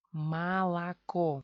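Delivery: Malaco slowly, ma-la-KO; drawn-out Malaco with a, a, then stressed o; reduced vowels. Shown as a demonstration. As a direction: Malaco syllable by syllable, Russian reduction. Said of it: "O se convierte en A".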